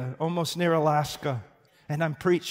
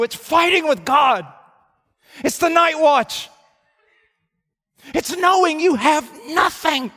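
louder, higher pulpit cry; rising preacher's fervor; preaching climax; 10 semitones higher, 8 dB louder than usual. Acted. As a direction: build to a fervent peak. Preaching at full force.

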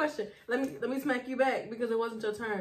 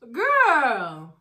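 incredulous; 2 semitones higher, 11 dB louder than usual. Real, not acted. Disapproving reaction.

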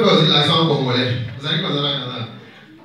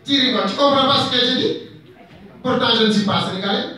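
put-on higher-pitched Wolof accent; comic Wolof speaker, voice raised in pitch; 5 semitones higher, 3 dB quieter than usual. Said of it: "Par exemple, le Wolof, il dit".

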